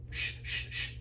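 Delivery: soft whispered shushing; whispered shh to calm the mother of the bride; hushing shh. Retold or imitated. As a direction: hush her urgently under your breath. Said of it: "all this lady is doing is going like this".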